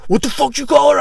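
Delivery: high, loud angry husband. Retold or imitated. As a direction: confront him like an angry husband. Husband confronts him, retold.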